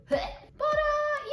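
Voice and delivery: high breaking laugh; nervous laugh. Real, not acted. Nervous laugh recalling near death.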